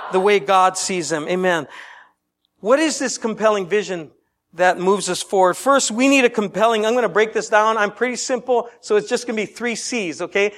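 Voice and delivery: normal talking voice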